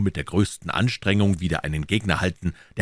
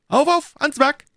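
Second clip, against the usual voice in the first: very high, louder rallying cry; calling out; 6 dB louder than usual. A narrator's reading. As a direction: rouse your men with a high call. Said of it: "rief Jussac".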